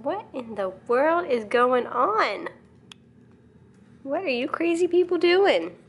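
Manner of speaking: slow, high pet voice; talking to the dog. Pretend. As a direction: ask the dog in a high, drawn-out voice.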